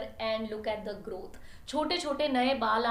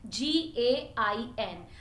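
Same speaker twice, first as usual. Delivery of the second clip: slow, letter by letter spelling; spelling Folligen letter by letter for viewers; much slower than usual. Shown as a demonstration. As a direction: spell each letter clearly and slowly. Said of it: "spelling है".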